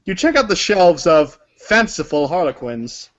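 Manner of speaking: normal talking voice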